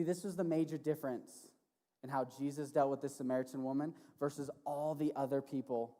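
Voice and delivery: ordinary talk, nothing marked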